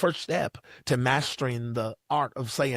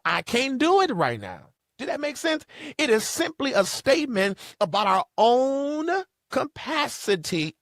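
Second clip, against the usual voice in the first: higher, louder sermon climax; preaching at full force; 7 semitones higher, 3 dB louder than usual. Acted. Preacher telling the congregation that no is not rejection, in a higher, louder preaching voice. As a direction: drive it home to the congregation.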